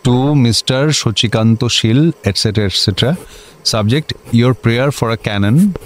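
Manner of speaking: each English word spelled out very slowly; officer dictating a letter at a crawl; slow dictation. Acted. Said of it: "একটা dictation নিন তো".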